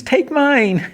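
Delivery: very high little figure's cry; imitated pleading robot. Mimo drops down offering life to Huntress, high mock plea. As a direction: mimic a small character offering itself.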